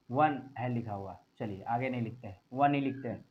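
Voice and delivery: low, soft self-talk; muttering while writing. Real, not acted. Teacher to himself while writing on the board, low mutter. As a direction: talk quietly to yourself as you write.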